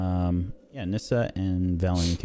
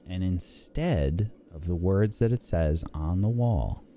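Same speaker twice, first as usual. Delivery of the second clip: ordinary talk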